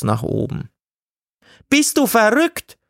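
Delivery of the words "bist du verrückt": very high, loud outraged shriek; shrieking seller. A narrator's reading. Gailana shrieks at God after he vomits on the grill. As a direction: shriek in disgust. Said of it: "schrie Gailana auf".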